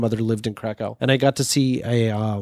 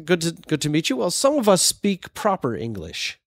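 higher-pitched, half-hearted mock British gent; old British dude; 5 semitones higher than usual. Old British man snubbing the writer he just met, in a mock-snooty voice. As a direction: imitate a snooty put-down, slowing at the end. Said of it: "This old British dude comes up to me".

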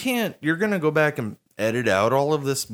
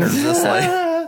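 teasing drawl; 5 dB louder, slower than usual. Real, not acted. Teasing drawn-out question.

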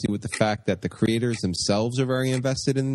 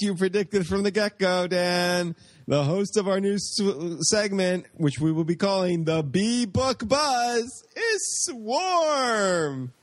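dramatic announcement; 6 semitones higher, slower than usual. Acted. Dramatic announcement of new host.

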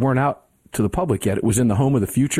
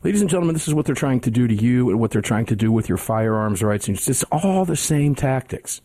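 normal talking voice

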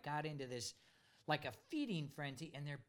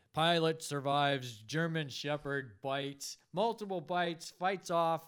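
slow, louder dramatic headline read; mock news headline; 9 dB louder, slower than usual. Pilot jokingly imagines a heroic dog-attack headline, slow loud announcer.

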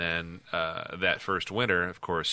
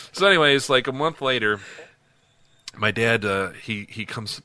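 normal talking voice

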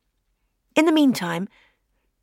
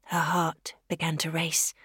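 suspense whisper; 4 semitones lower, 7 dB quieter than usual. A narrator's reading.

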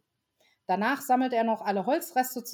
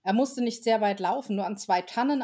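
plain reading voice

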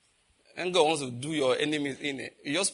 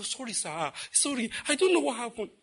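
put-on pleading boy; 7 semitones higher than usual. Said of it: "He knelt and prostrate on the ground".

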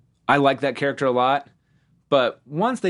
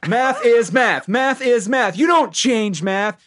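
higher, louder, drawn-out mock outrage; exasperated dad; 9 semitones higher, 4 dB louder, slower than usual. Dad refusing the new way of doing math, mock exasperation. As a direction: hammer out each word like a fed-up father. Acting dad's rant about new maths.

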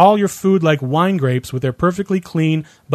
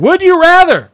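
high, drawn-up game-show call; game catchphrase; 7 dB louder than usual. Pretend. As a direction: sing out the question, high and loud. Game catchphrase called out each round.

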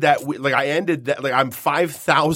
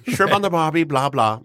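higher, hammed-up Aussie catchphrase; Australian accent; 4 semitones higher than usual. Host running through stock phrases, mock Australian accent. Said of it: "It's Australian".

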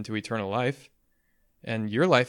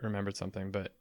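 trailing off; 2 semitones lower, 8 dB quieter, faster than usual. Real, not acted. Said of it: "I lost my train of thought".

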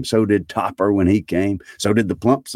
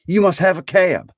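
high, insistent Scrooge voice; giddy Scrooge, pitched up; excited Scrooge; 13 semitones higher, 3 dB louder than usual. A narrator's reading. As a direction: exclaim it high and eager.

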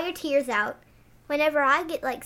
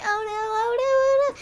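climbing higher and higher with each oh no; rising panicked oh no; 10 semitones higher, 4 dB louder than usual. Retold or imitated. Scared girl in her dream, rising panic. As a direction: let the panic build up high. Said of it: "And I'm like".

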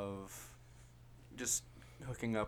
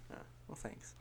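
whisper; 13 dB quieter than usual. Real, not acted. Quiet aside after compliment.

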